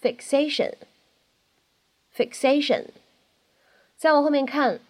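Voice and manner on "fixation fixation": "fixation" with an unreleased k sound; incomplete plosion. Shown as a demonstration. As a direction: hold the k in "fixation", don't let it pop. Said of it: "它好像呢只做了口型".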